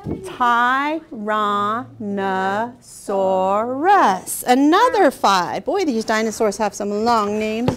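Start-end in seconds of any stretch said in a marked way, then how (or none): 0.1-4.4 Tyrannosaurus stretched slowly, one syllable at a time. Shown as a demonstration. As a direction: sound out Tyrannosaurus for counting. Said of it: "how about Tyrannosaurus".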